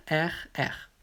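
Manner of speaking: ordinary talk, nothing marked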